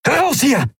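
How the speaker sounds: high-pitched urgent cry; urgent call. A narrator's reading. Leader to his crew as the trap closes, urgent call. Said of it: "rief er".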